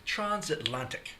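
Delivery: put-on transatlantic said slowly in old-timey transatlantic accent; drawn-out posh transatlantic pronunciation. Shown as a demonstration. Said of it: "or as they would say".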